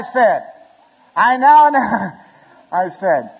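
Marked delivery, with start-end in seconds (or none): 1.2-2.2 high, loud attempt that breaks off; mock choked-up officiant; imitating breaking down. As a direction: start the pronouncement, then crack mid-word. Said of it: "I started crying".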